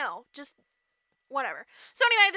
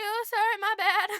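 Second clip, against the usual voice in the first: high little apology; sheepish stepsister; 6 semitones higher, 4 dB louder than usual. Retold or imitated. Stepsister apologises to furious prince, high and sheepish. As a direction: toss off a squeaky mock apology.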